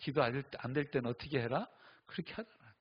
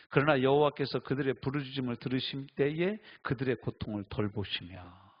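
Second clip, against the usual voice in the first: scripture reading; 5 dB louder than usual. Acted. Scripture read again.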